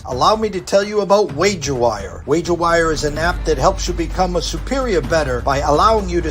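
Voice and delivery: upbeat sponsor read; lively commercial voice. Acted. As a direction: sell the app like a radio spot. Reads a sponsor ad.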